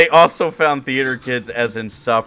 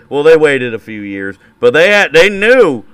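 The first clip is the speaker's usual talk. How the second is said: higher and louder, a hammed-up conspiracy rant; building mock rant; 5 semitones higher, 9 dB louder than usual. Host ranting mock history to his co-host, rising hammed-up rant. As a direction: swell into a ridiculous conspiracy rant.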